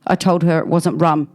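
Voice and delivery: ordinary delivery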